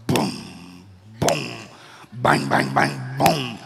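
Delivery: loud punchy booms imitating pad hits; imitated punches. Imitating the Russian's punches through the wall, loud thudding booms. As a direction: boom out the thuds like cannon fire. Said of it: "It sounded like cannons were going off".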